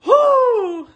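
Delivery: very high celebratory whoop; high, drawn-out cheer; excited screaming. Real, not acted. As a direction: whoop with real joy. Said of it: "sorry for the screaming".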